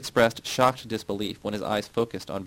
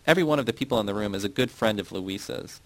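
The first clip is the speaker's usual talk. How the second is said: ordinary delivery, nothing marked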